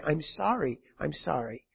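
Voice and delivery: hushed, heavy-hearted sorry; sorrowful apology. Shown as a demonstration. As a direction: let the apology fall almost silent.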